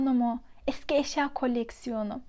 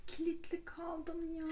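real half-laugh, half-cry at being stuck; higher, laughing helplessly, then fading soft; laughing in dismay; 5 semitones higher, 3 dB quieter than usual. Real, not acted. Scene: just locked herself in the room, laughing in dismay.

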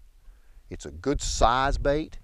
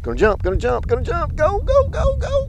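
excited urging. Real, not acted. Fish jumping while landing it.

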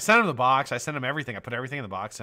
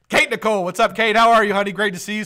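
loud, high, delighted welcome; excited call-out; 9 semitones higher, 10 dB louder than usual. Real, not acted. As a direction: greet a favorite regular at full volume. Hailing an arriving viewer.

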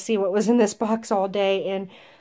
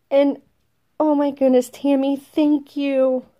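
heartfelt thanks; 6 semitones higher, 5 dB louder than usual. Real, not acted. Thanking the gift giver.

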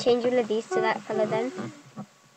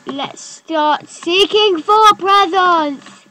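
slow, louder, higher; excited; 7 semitones higher, 8 dB louder, much slower than usual. Real, not acted. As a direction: drag it out with glee. Announces the hunt.

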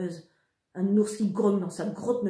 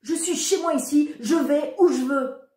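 yelling; 8 semitones higher, 6 dB louder than usual. A narrator's reading. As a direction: scream it back at mother. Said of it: "dis-je en hurlant". (l'ado hurle à sa mère, avec défi)

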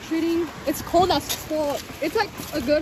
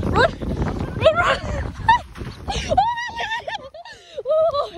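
being chased; 13 semitones higher, slower than usual. Real, not acted. Running off, laughing and screaming.

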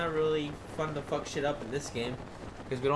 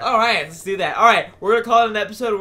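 loud excited sign-off; 6 semitones higher, 13 dB louder, faster than usual. Real, not acted.

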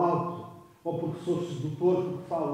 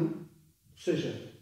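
ordinary delivery

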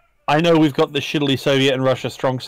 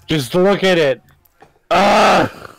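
loud and high with delight; excited; 6 semitones higher, 5 dB louder, slower than usual. Real, not acted. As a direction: gush over it, then cry out. Excited over the jet.

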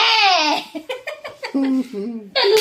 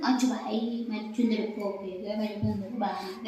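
put-on softer cooing baby talk, lisping चुंदर for सुंदर; 3 semitones lower, 8 dB quieter than usual. Mother retells cooing to her sulking pet. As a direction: coo at the pet like a toddler. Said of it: "मग बोलले".

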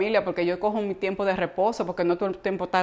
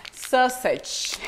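Spanish accent on English; 3 dB quieter than usual. Shown as a demonstration. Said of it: "yo tengo mi acento".

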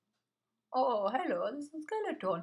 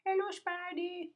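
mock sweet greeting; 11 semitones higher, slower than usual. Real, not acted. Greeting a spider mob.

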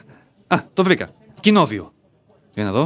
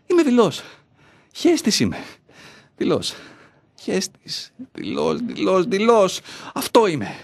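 self-loathing; 5 semitones higher than usual. Acted. Berating himself as coward.

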